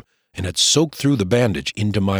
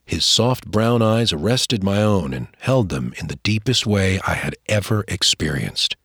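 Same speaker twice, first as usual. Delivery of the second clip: ordinary delivery, nothing marked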